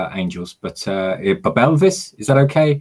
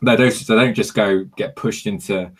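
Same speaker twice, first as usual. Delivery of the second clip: ordinary talk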